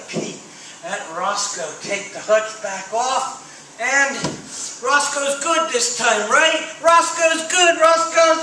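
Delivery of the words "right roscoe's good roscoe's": excited. Acted. Excited reveal to children.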